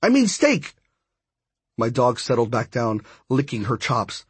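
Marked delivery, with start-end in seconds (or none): 0.0-0.8 high, hurried panicky correction; urgent yell. A narrator's reading. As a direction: yell the correction before it's too late. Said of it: "I yelled".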